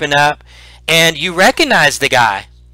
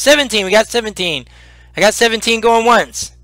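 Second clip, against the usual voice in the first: high-pitched auction call; auctioneer; 7 semitones higher than usual. Acted. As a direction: announce the bid to the crowd. Goblin auctioneer calling bids.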